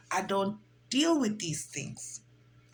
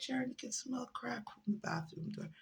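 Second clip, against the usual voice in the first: soft whisper, much quieter; whispered explanation; 3 semitones higher, 11 dB quieter than usual. Retold or imitated. Sister whispers to Sharon about the crack smell. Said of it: "She said".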